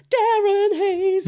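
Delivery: very high, slow, loud falsetto; imitating Johnny. Johnny mid-take saying the name in high falsetto. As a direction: sing the name way up in a breathy falsetto. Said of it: "he literally just goes to me".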